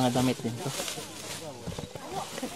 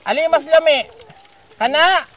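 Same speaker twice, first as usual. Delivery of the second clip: ordinary talk, nothing marked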